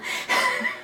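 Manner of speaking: high, embarrassed giggle; short high-pitched laugh; awkward laugh. Real, not acted. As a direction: giggle at your own awkward wording. Laughs at her own awkward wording.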